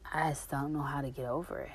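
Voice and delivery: soft, whispered admission; whispered confession. Real, not acted. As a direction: admit it almost under your breath. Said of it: "confessing resentment".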